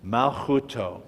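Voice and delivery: Malchuto with a guttural ch and the stress on TU; teacher models Malchuto for the class; Hebrew model word. Shown as a demonstration. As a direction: say Malchuto as mal-khu-TOE, ch from the throat. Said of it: "that's the next word".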